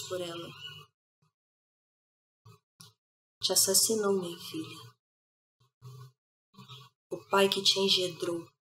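hushed, low lament with long pauses; quiet tragic lament; grieving mother. Acted. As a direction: mourn your murdered child softly, pausing often. Mother laments her killed daughter.

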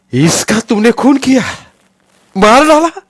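very high, very loud accusing scream; sky-splitting scream. Acted. Deu screaming at the police over the dead child, full scream. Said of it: "आकाश फाटणाऱ्या आवाजात मोठ्यानं किंचाळला".

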